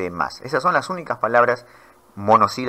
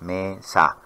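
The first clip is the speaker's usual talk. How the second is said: "mesa" said as two separate beats, me, sa; split into syllables; 2 semitones lower, 3 dB quieter than usual. Shown as a demonstration. Teacher showing students two syllables, "mesa" syllable by syllable. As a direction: break "mesa" after the first syllable. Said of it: "tiene dos sílabas".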